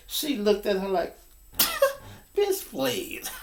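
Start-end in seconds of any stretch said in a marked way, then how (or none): 1.6-2.1 scoffing laugh. Retold or imitated. Woman scoffs. 2.4-3.3 laughing dismissal. Retold or imitated.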